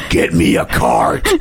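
low, slow whispered growl; hangry. Retold or imitated. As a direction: imitate a hangry demand, laughing. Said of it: "your hangry voice came out".

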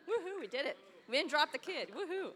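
high, loud joyful whoop; excited cheer. Real, not acted. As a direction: celebrate like you just made it. Cheering after finishing their share.

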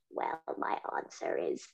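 very low, slow, rasping robot voice; croaky Dalek. Retold or imitated. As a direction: grind it out like a sick Dalek. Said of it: "like talking to a, a croaky Dalek".

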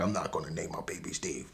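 whispered, hushed retort; mock whisper. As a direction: whisper it dismissively. Mother rejecting name, retold.

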